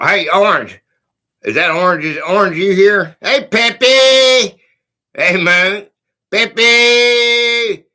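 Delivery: loud, eager greeting; calling out. Real, not acted. Streamer loudly greeting viewers by name, calling out. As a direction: call out to friends across a room.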